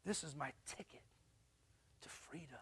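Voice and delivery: whispered, secretive glee; a teen whispering his escape plan. Retold or imitated. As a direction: breathe it like a secret you can't wait to use. Teen gloating about his license.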